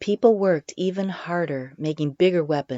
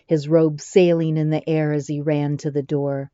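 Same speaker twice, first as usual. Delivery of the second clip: ordinary delivery, nothing marked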